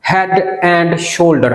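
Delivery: very slow Head and shoulder; slow demo. Shown as a demonstration. Teacher introducing the example slowly. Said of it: "हम बोलेंगे head and shoulder".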